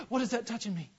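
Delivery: high startled little question; startled child. Retold or imitated. Frightened child to a parent touching them in the dark, startled.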